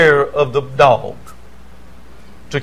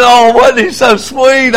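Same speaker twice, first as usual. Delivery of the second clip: imitating doting wife; 8 semitones higher than usual. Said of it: "She said".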